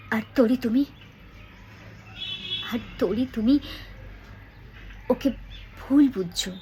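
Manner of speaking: measured line, a pause before a weighted ভুল; stress on ভুল. Shown as a demonstration. Teacher shows how she acted the line, stressing ভুল. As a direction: hold back, then set ভুল apart. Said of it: "ভুলের ওপর জোর দিয়েছি".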